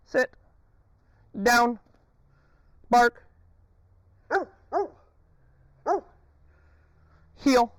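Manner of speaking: high, loud call to the dog; sharp command. Real, not acted. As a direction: snap the command out.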